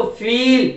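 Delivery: "फील" with a drawn-out ee; long E. Shown as a demonstration. Teacher shows how to say it, long "फील". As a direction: stretch the middle of "फील". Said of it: "ऐसे बोलना आपको".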